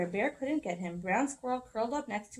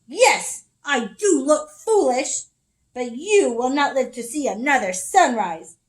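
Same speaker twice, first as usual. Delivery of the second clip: growled; 5 semitones higher, 12 dB louder than usual. Acted. Bear pinning Squirrel, loud growling threat. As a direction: growl it, building to a loud high roar. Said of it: "Bear growled".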